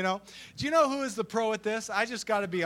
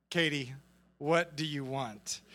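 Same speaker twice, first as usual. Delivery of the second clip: low, slow, softer question; mock weary dad; 7 semitones lower, 6 dB quieter than usual. Imitating father questioning his sweet-talking daughter, low and slow. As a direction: ask it slowly, knowing what's coming.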